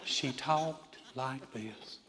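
very slow, soft Southern drawl; Southern accent. Retold or imitated. Preacher mimicking the office admin's talk, slow Southern drawl. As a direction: stretch it out like a slow Southern lady. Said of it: "had the most southern accent I have ever".

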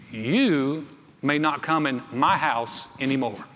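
slow, measured, firm order; firm retold banishment. Husband barring his sick wife's friend, slow and firm. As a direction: lay down the law slowly, word by word.

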